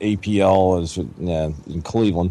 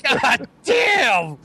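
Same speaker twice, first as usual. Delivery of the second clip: shocked shout; 12 semitones higher than usual. Retold or imitated. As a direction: yell it in disgust at the mess. Retold disgust in car.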